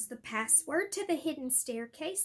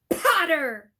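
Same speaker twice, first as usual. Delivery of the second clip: loud, sharp call of a name; 2 semitones higher, 13 dB louder than usual. Acted. Snape to Harry, a loud sharp call. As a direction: stop him with one word.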